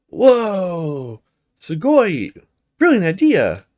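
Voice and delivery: delighted cry, high and loud; thrilled. Acted. Bubbly girl cheering a friend's idea, excited high cry. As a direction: gush with bright excitement over the idea.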